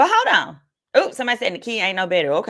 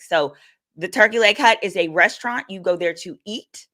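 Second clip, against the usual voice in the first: ordinary talk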